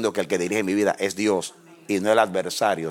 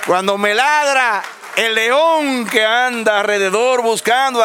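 high, loud preacher's cry; fiery preaching; 6 dB louder than usual. Acted. Pastor rouses the congregation against the devil, loud high preaching. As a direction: preach it to the back row.

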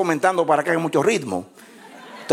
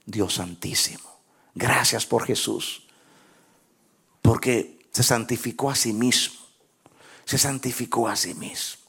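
prayer; 7 semitones lower, 4 dB quieter than usual. Acted. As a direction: pray gently and earnestly. Leads closing prayer.

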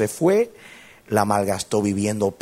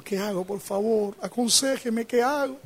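high, climbing voice of a distraught mother; imitated tearful plea. Mother begs pastor over jailed son, imitated tearful plea. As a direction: act out a mother begging in tears, pitch rising.

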